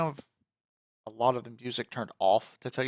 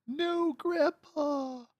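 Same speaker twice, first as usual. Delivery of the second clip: much higher, drawn-out hammed-up wail; mock anguish; much slower than usual. Grandson as grandpa dies, hammed-up slow wail. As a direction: wail it slowly like a melodramatic grieving grandson.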